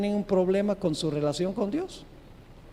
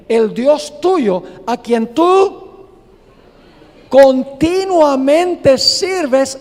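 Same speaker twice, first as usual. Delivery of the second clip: súplica angustiada del rey; 6 semitones higher, 8 dB louder, much slower than usual. Acted. Rey suplica a Daniel ante foso.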